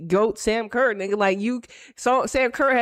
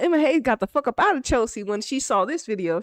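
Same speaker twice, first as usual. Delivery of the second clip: ordinary talk, nothing marked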